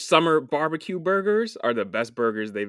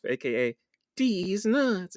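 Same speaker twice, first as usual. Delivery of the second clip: announcer voice; 6 semitones higher, slower than usual. Pretend. Nickname intro, put-on voice.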